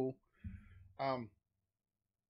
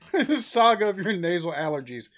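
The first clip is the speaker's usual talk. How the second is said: louder, laughing repeat of the phrase; amused laughter; 4 semitones higher, 8 dB louder than usual. Real, not acted. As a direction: say it while cracking up. Cracking up at the video's line.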